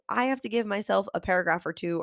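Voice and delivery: ordinary talk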